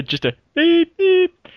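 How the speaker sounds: high, loud beep imitation; sung high beep, beep; mock censor beep. Shown as a demonstration. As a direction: squeal it like a censor bleep. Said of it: "it's gonna just become a".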